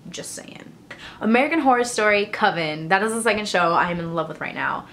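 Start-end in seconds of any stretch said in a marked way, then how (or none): 0.1-0.7 whispered aside. Shown as a demonstration. To viewers about Michonne, a secret whisper.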